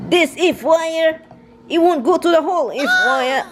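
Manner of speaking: hammed-up fussy husband; imitating husband.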